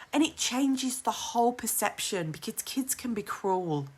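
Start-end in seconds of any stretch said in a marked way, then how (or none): 2.3-3.9 unvoiced whispered aside; whispered under the breath. Real, not acted. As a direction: breathe it out as a bitter secret. Aside about cruel kids.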